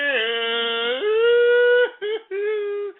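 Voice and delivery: loud high-pitched cackle; high cackling laugh. Real, not acted. Host laughing at his own drinking joke, a loud high cackle.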